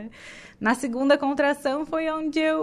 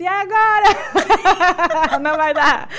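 high, loud laughing panic; half-laughing mock despair; panicked outcry; 7 semitones higher, 11 dB louder than usual. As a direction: laugh through the retold alarm. Stopping in the corridor mid-labour.

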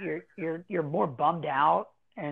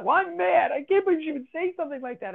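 put-on much higher, fast, worked-up kid voice; a child blurting out how outraged she is; imitating an indignant child; 11 semitones higher than usual. Upset child says she's mad.

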